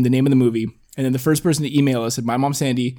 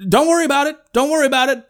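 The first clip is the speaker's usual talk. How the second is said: high, loud impression of the actor; hammed-up celebrity imitation; Morgan Freeman; 14 semitones higher, 5 dB louder, faster than usual. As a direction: urgently talk someone down, voice pitched up. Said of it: "actually not a bad Morgan Freeman".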